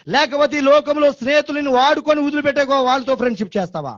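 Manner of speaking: high, ringing preacher's cry; fiery preaching. Acted. Preacher to the church, God's promise, high and impassioned. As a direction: proclaim God's promise to the congregation.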